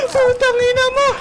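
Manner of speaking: high-pitched swear straight after a scream; shaken curse. Real, not acted. Player cursing what scared him, high panicked swear. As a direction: cry the curse out in fright.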